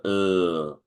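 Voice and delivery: "l" held with tongue behind upper teeth; held L sound. Shown as a demonstration. Teacher showing where the tongue goes, "l" drawn out. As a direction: sustain "l", tongue tip on the alveolar ridge. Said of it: "Ahí pones la lengua cuando produces".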